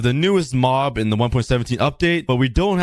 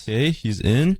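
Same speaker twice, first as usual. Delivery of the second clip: ordinary delivery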